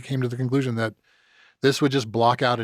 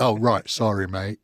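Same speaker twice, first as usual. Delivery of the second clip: a light British "Sorry, mate"; breezy British backpedal; British apology. Retold or imitated. Mimicking the Brits backtracking, light British accent. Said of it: "the Brits come back to you like that".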